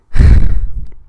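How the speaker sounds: loud, exasperated exhale; exasperated sigh. Real, not acted. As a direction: let out a heavy, fed-up sigh. Exasperated pause in rant.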